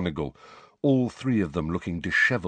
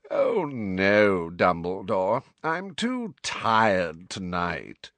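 fake tired; much slower than usual. A narrator's reading. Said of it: "fake yawn".

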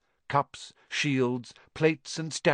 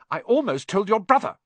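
high, louder indignant snap; snapping; 9 semitones higher, 6 dB louder than usual. A narrator's reading. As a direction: snap it out in a raised voice. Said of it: "Hermione snapped".

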